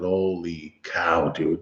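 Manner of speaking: soft, slow, stunned; hushed awe. Retold or imitated. Storyteller recalls seeing the bloody rabbit, hushed and slow. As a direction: let it fall out slow and quiet.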